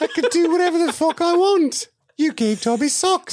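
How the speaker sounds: high squeaky elf, laughing through it; sassy Dobby. Retold or imitated. Co-host's Dobby brags to Harry, high and laughing. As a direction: squeal it with cheeky defiance.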